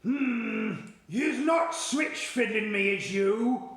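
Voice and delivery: suspicious Blood Butler. Acted. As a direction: growl out your suspicion. Suspicious giant questions BFG.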